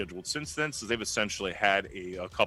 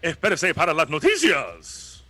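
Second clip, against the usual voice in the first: loud Spanish-language announcer voice; Spanish radio announcer; 7 semitones higher, 7 dB louder than usual. Retold or imitated. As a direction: boom it out like Spanish radio news. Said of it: "Say that in Spanish to him".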